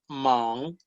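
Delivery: "man" with a rising second tone and nasal ending. Shown as a demonstration. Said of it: "en el segundo tono".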